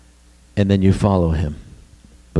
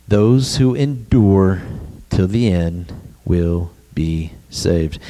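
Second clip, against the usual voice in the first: slow solemn quote; 3 semitones lower, slower than usual. Shown as a demonstration. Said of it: "Jesus said one thing".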